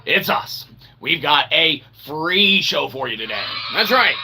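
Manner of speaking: ordinary talk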